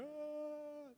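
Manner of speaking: high mock wail; fake tearless cry. Baby cries without tears, high mock wail. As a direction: imitate a baby crying with no tears. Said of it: "chorando sem sair lágrima".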